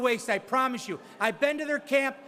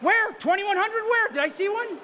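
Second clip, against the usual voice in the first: brisk auctioneer patter pushing for the next bid; auctioneer bid calling; 6 semitones higher than usual. Real, not acted. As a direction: call the bids like a charity auctioneer. Auctioneer running live bidding.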